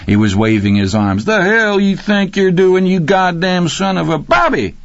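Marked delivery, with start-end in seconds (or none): 1.3-4.2 high-pitched, outraged tirade; high, indignant hollering; angry cursing. A narrator's reading. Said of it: "profanity spilling out of his mouth". 4.2-4.8 high, ecstatic cry; gleeful shout of a name; delighted yell. A narrator's reading. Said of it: "Rocky yelled, his delight nearly orgasmic".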